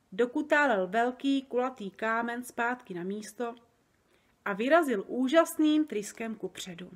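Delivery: ordinary delivery, nothing marked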